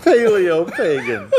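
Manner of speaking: slow, high, chuckling repeat of the punchline; laughing repeat. Real, not acted. Relishing his cohost's pun, slow and laughing. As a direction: savor the words through a laugh.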